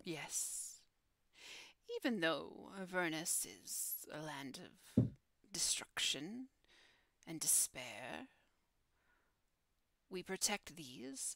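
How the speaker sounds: hushed, half-whispered guardian voice with long pauses; solemn guardian. Acted. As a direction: speak as a solemn keeper of dark stories. Game master plays solemn guardian.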